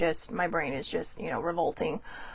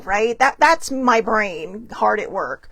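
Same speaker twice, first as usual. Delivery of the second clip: usual speaking voice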